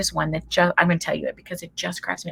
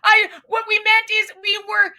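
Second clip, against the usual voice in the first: high, loud frantic mom, laughing through it; high, loud mock panic between laughs; panicked mom; 7 dB louder than usual. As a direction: shriek it like a mortified mother. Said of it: "she says".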